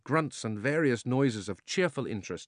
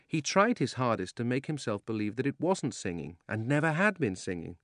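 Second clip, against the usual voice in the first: plain reading voice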